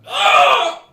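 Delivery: sudden high, loud scream; high-pitched shriek from down the row. Acted. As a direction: let out a startled full-volume scream. Scream heard by the whole party.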